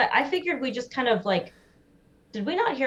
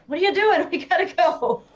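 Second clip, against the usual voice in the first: high, laughing urgent plea; urgent hushed call; 10 semitones higher, 4 dB louder than usual. Retold or imitated. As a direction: hurry a friend along, half laughing. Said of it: "looking at Agnes like".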